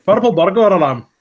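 put-on rough Scottish accent on purple burglar alarm; hammed-up Scottish accent. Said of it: "I can do a Scottish accent".

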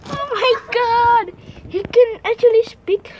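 high-pitched, playful astonishment; amazed. Pretend. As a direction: exclaim high, marvelling at a talking cat. Amazed that cat speaks.

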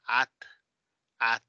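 "At" with open Spanish a. Shown as a demonstration. Teacher showing what not to say, Spanish vowel. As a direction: say "At" with plain ah vowel. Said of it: "como se dice en español".

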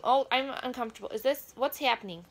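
ordinary talk, nothing marked

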